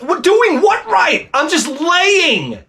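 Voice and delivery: high, drawn-out mock protest; mock yelled protest. Husband answering his yelling wife in the pool, mock yelled protest. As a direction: yell back in a stretched, high-pitched voice.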